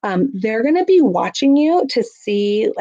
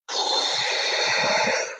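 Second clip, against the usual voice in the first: long slow exhale, clearly heard; big audible breath; 6 dB quieter than usual. Shown as a demonstration. Parent showing a child a calming breath: long audible exhale. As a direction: breathe out so the child can hear it. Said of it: "or the breathing is, you know, like".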